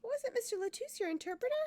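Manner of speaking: ordinary talk, nothing marked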